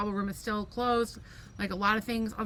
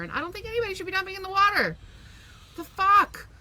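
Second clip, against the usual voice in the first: indignant outburst; 10 semitones higher, 6 dB louder than usual. Real, not acted. Indignant rant about dumping.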